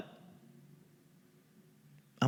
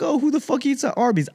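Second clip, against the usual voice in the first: a touch of a higher, scoffing voice; mocking everyone; 12 semitones higher, faster than usual. Comic mimicking people who sneer at Arby's, higher. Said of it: "Everyone's like".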